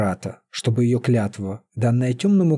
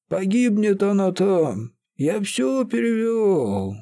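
weeping wail; 8 semitones higher than usual. A narrator's reading. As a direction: wail it through tears. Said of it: "заголосил прорицатель и вдруг заплакал".

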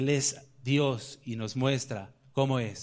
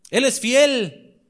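higher, louder, slow ringing declaration; slow proclaiming; 9 semitones higher, 9 dB louder, much slower than usual. Acted. Preacher declaring to the doubting congregation, slow and loud. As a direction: stretch it out like a pulpit proclamation.

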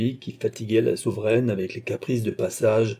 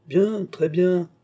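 slow, higher, louder exclamation; exclaimed; 8 semitones higher, 4 dB louder, much slower than usual. A narrator's reading. Old father gloating at his son, slow and high. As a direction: savour it, drawn out and high. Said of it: "s'écria le vieillard".

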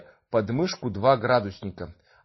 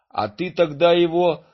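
excited; 6 semitones higher, 6 dB louder than usual. Acted. Chuba whispering advice to granny.

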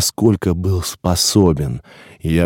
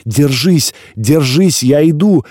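loud, higher urgent shout; urgent call; 6 semitones higher, 4 dB louder than usual. A narrator's reading. Receiver shouts to the suffocating newcomer, loud and urgent. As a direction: call out to him, hold on. Rescuer calls through fog.

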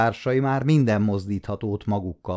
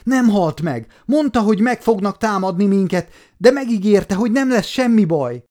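very high, loud desperate wailing; hysterical sobbing cry; 10 semitones higher, 6 dB louder than usual. Acted. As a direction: scream at him while beating his chest. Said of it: "abbahagyja a zokogást". (hisztérikus, zokogó kiabálás)

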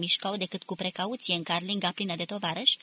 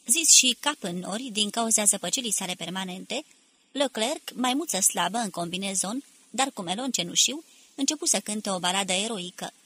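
plain reading voice

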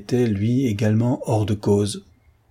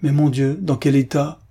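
higher, alarmed exclamation; crying out; 5 semitones higher, 3 dB louder than usual. A narrator's reading. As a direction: exclaim in dismay, pitched up. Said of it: "s'écria le concierge".

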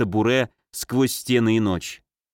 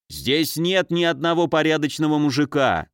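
usual speaking voice

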